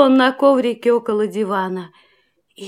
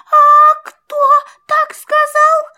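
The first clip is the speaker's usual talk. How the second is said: little mouse voice; 13 semitones higher, 6 dB louder than usual. A narrator's reading. Frightened mouse speaks.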